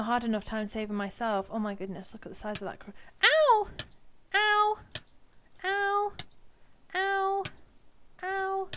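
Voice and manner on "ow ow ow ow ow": loud high yelps of ow; yelping in pain. Real, not acted. Player getting hurt by poison, loud yelps. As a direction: cry out each hit, sharp and high.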